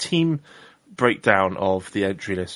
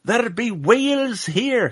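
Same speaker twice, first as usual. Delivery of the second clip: bad hammed-up Scottish accent, high and slow; pirate-ish Scottish sailor voice, drawn out; 13 semitones higher, 4 dB louder, slower than usual. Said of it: "that was a terrible Scottish accent".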